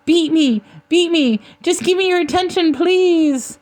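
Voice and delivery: high-pitched, begging creature cry; Milo. A narrator's reading. Creature begging the marauder for attention, high pleading cry. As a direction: beg desperately in a put-on high voice. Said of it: "Milo cried".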